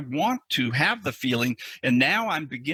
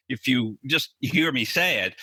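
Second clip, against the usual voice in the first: normal talking voice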